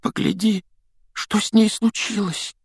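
very high, fragile quaver; trembling voice. A narrator's reading. Ron shows Harry his broken wand, trembling voice. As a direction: lament in a high, shaking voice. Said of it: "дрожащим голосом произнёс Рон".

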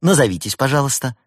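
high, lively helpdesk request; cheerful young technician. A narrator's reading. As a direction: ask brightly like a young support worker. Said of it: "молодой и жизнерадостный".

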